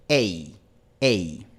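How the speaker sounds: "ei" terminando en i cerrada como en fleece; diptongo español. Shown as a demonstration. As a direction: cierra el "ei" hasta la i. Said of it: "I, como en fleece en inglés". ("ei" español cerrado)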